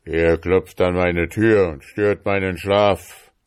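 loud, altered voice from inside a mountain; mighty booming voice. A narrator's reading. Father woken inside the mountain, mighty booming voice. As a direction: boom it out like an angry giant woken. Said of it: "die Stimme war so gewaltig".

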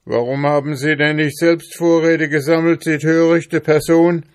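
high, loud, quick scolding; sharp, irritated telling-off; angry snapping. A narrator's reading. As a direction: snap at her in reproach. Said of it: "fauchte Ambrosius Dauerspeck sie an".

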